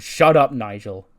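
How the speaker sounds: loud, slow put-down; loud, slow mock shut-down; retold snap. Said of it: "She goes".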